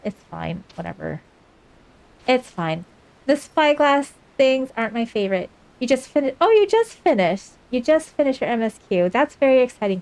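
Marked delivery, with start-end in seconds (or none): 0.0-1.2 it's fine, whatever, muttered much softer and lower; sulky mutter. Real, not acted. Grumbling to chat about the dreaded spyglass task, sulky and soft. 6.4-10.0 sudden high burst of cheer; light up mid-sentence for the viewer; excited. Real, not acted. Congratulating a viewer who just finished the main quest, excited.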